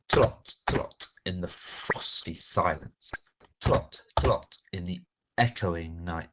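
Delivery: "echoing" stretched out slowly so it echoes; drawn-out echoing. Shown as a demonstration. Teacher stresses the sounds for her pupils, slow. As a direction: draw out "echoing" and "frosty", every sound crisp. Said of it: "extending the, the word echoing".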